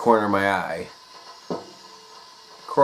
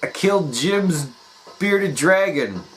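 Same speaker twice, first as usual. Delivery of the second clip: blurted confession; 8 semitones higher, slower than usual. Retold or imitated. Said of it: "and I go".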